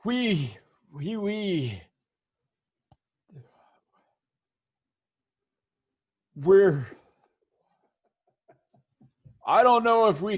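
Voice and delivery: halting, stammered reluctance; falter, restart, then hurry out an excuse; mildly nervous hired man backing out; uneasy guard. Acted. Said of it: "he doesn't meet your eye".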